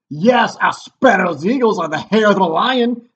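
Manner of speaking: loud, high, hammed-up Scottish captain; terrible Scottish accent. Wounded captain reports the battle to the king, mock Scottish shout. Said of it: "my terrible Scottish accent when I read the part of the captain".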